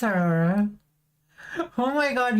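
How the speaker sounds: normal talking voice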